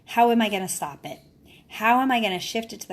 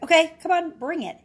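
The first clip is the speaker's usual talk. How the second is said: mock challenge; 9 semitones higher, 6 dB louder than usual. Mock fight with daughter.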